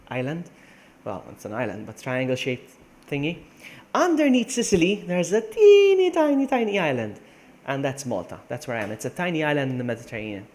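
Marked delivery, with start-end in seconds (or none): 3.9-7.2 playful emphasis. Real, not acted. Streamer showing viewers how tiny Malta is, playful high emphasis. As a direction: stretch it out high and loud, easing down at the end.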